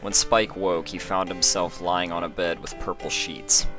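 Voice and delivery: ordinary delivery, nothing marked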